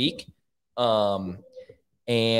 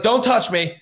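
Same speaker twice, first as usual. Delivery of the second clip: mock alarmed rebuff; 9 semitones higher, 4 dB louder than usual. Acted rebuff of the helping priest.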